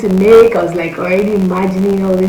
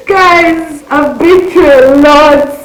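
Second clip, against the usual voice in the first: high, loud, slow wail of complaint; dramatic outcry; 7 semitones higher, 5 dB louder, much slower than usual. Real, not acted. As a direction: cry out to the viewers. Dramatic cry to viewers.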